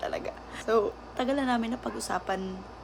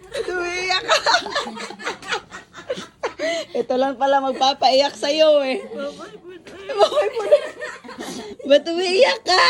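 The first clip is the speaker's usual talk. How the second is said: loud, high voice breaking into laughter; laughing tease; 9 semitones higher, 9 dB louder than usual. Real, not acted. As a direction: tease the crier through big laughs. Laughing at friend's happy tears. (malakas na pang-aasar habang tumatawa)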